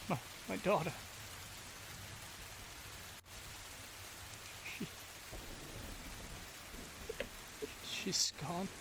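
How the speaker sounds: barely getting the words out in shock; stunned grief. Acted. Father learning his daughter is gone, in soft shocked grief. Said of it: "he starts crying".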